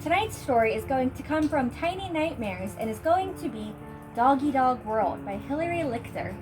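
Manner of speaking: plain reading voice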